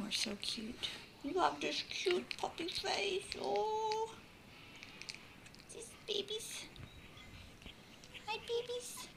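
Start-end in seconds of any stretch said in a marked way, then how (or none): none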